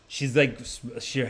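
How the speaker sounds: ordinary talk, nothing marked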